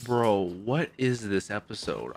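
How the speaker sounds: ordinary talk, nothing marked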